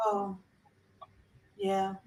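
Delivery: soft, distracted reply; a lightly done numb father; dazed reply. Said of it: "And he said".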